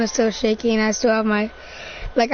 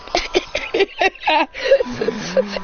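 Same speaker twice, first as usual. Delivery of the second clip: higher-pitched crazed laugh; mad shared laughter; 4 semitones higher than usual. Acted. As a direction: laugh along with your fellow madman. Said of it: "hugging each other and laughing".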